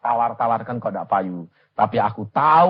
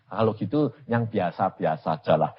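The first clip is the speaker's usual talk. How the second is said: normal talking voice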